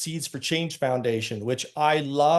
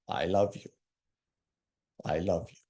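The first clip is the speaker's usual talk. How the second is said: tender I love you; 7 semitones lower, 4 dB quieter than usual. Retold or imitated. Comforting a weeping man.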